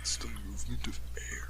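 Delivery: whisper. Real, not acted. Prompting a friend's answer.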